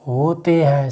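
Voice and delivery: slow, spaced dictation; dictating at a crawl. Shown as a demonstration. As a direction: stretch each word for note-taking. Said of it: "नीचे note करके लिख देते हैं".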